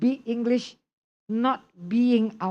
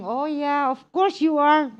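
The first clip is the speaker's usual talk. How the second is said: higher-pitched mock-eager agreement; teasing agreement; 5 semitones higher than usual. Listener answering someone who says "I'm boring", teasing agreement. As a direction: pounce on it with exaggerated, high agreement. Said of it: "If you say, "I'm boring".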